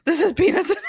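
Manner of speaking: high, loud, laughing alarm; mock panic. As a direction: blurt it out in hammed-up horror. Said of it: "Instead of".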